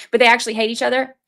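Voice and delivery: normal talking voice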